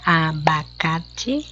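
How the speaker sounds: "abacate" very slow, split a-ba-ca-te; syllable by syllable. Shown as a demonstration. Teacher correcting the syllable exercise, syllable by syllable. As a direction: stretch out each syllable of "abacate". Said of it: "ó, como eu separei".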